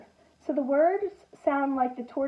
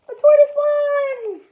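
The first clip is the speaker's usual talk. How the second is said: excited repeat; 12 semitones higher, 8 dB louder than usual. Shown as a demonstration. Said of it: "repeats the line".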